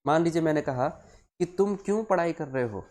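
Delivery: ordinary talk, nothing marked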